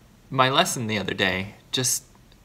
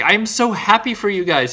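happy for them; 9 semitones higher, 6 dB louder than usual. Retold or imitated. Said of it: "And I was like".

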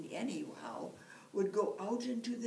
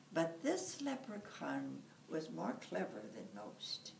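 ordinary delivery

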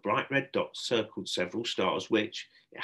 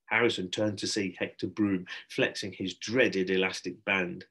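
ordinary delivery, nothing marked

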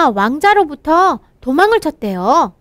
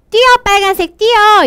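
calling out loud to run; 다급하게 외치는 뛰어; urgent call; 6 semitones higher, 4 dB louder than usual. Acted. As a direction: shout it like a warning. Acted call with a wrong meaning.

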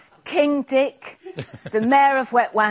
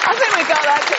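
ordinary talk

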